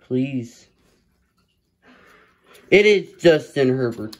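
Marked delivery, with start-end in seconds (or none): 2.7-4.2 louder, higher burst of real excitement; excited announcement. Real, not acted. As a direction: call out the big pull with delight. Excited at pulling the card.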